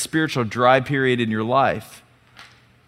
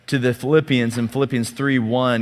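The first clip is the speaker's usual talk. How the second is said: ordinary delivery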